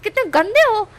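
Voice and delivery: high, loud disgusted snap; disgusted scolding. Acted. Wing freeing himself from guards, loud disgust. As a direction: shove them off with contempt. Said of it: "इस तरह से wing बोलता है".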